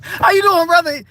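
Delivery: high, loud, gleeful hello; hammed-up friendly shout; exuberant greeting. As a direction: sing out a greeting well above normal pitch.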